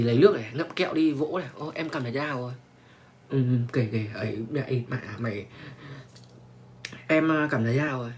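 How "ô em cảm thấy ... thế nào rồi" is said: soft, hushed, lower coaxing tone; imitating a hushed, caring whisper to a girl; gentle soft voice. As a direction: coo it quietly like a guy soothing his date. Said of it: "giọng nhẹ nhàng lắm".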